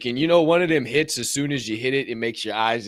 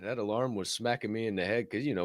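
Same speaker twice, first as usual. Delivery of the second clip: usual speaking voice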